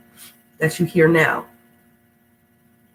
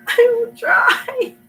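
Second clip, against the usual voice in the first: very slow and much higher, laughing through tears; laughing crying; 3 dB louder, much slower than usual. Real, not acted. Sick reader laughing at herself as she promises to try. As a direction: squeak it out half laughing, half crying. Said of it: "pathetically ecstatic and crying".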